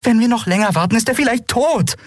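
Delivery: mounting high-pitched alarm; agitated. A narrator's reading. Captain listing what could kill Kai, increasingly agitated. Captain lists dangers in heated quarrel.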